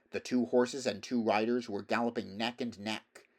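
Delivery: usual speaking voice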